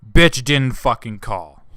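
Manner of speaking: loud, slow, bitter outburst. Real, not acted. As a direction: spit each word out slowly and loudly. Angry outburst at ex.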